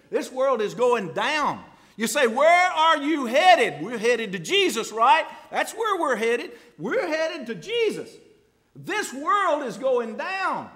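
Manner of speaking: loud, high preacher's cry; fiery preaching. Acted. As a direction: drive it home to the church. Preaching at full force.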